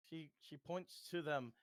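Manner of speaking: ordinary delivery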